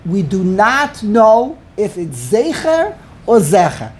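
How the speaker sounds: slow, every word weighed; slow, measured reading of the ruling; slow deliberate quote. Retold or imitated. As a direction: spell out the doubt slowly.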